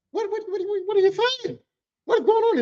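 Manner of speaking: very high-pitched stammered questions; shocked disbelief. Retold or imitated. Told by the boss it was him, high shocked stammer. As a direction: squeak out stunned confusion, pitch leaping up. Acts shock at being blamed himself.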